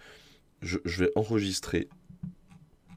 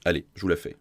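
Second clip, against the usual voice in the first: plain reading voice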